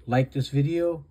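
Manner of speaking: usual speaking voice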